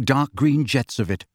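ordinary delivery, nothing marked

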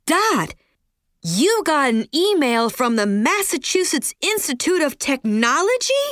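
high, stagey, wooden line reading; bad acting. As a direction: overact it like a bad play. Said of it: "like she was reading lines from a very bad play".

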